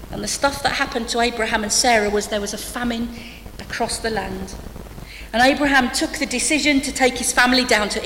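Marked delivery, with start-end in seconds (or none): none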